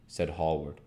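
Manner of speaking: ordinary delivery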